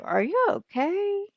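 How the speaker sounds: drawn-out, high-pitched pretend question; mock concern. Boy asks another camper if he's okay, mock concern. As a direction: check on them, slow and sliding high. Checking on another character in game.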